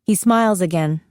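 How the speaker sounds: usual speaking voice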